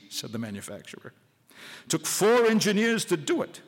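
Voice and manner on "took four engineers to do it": a proud, boastful brag; lightly voiced pride, showing off the work; proudly. Gribbon bragging to the visitors about his display, proudly. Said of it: "said Gribbon proudly".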